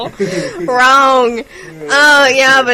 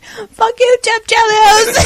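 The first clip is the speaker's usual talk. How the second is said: yelled insult; 10 semitones higher, slower than usual. Retold or imitated. Everybody yells at the hero.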